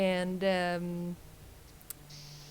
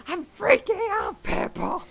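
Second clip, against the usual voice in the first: laughing, mock panic; 12 semitones higher, 6 dB louder than usual. Real, not acted. Laughing about the aurora.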